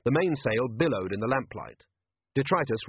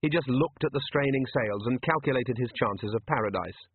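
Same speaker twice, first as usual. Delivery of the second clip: ordinary delivery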